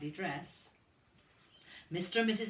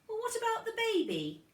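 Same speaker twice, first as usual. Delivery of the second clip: put-on high, quick little boy voice; small child voice; 3 dB louder, faster than usual. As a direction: squeak it like a tiny elephant child. Small child Luke asks, high voice.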